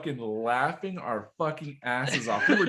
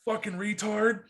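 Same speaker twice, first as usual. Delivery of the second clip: angry drill instructor; 8 semitones higher than usual. Acted. Drill instructor's parting insult, acted.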